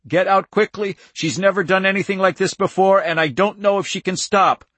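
loud, high hoarse warning. A narrator's reading. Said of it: "Andy said hoarsely".

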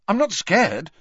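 high, loud, immediate retort; quick, firm denial. Acted. Harry denying fear to Dumbledore, high firm retort. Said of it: "said Harry at once".

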